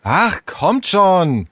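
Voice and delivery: high, drawn-out plea; exasperated coaxing. Acted. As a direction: drag it out, coaxing them impatiently. Sarah cajoles the others. (genervt gedehntes Drängen)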